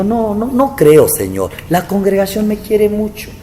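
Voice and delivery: high, slow protesting voice; incredulous denial. Retold or imitated. Pastor telling God his flock loves him, high incredulous protest.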